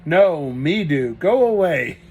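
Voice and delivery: loud, slow, stubborn refusal; stamping-foot defiance. A narrator's reading. Kate defending her chickens from Grigori, loud and stubborn. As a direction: plant your feet and push him off. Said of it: "Kate stamped her foot".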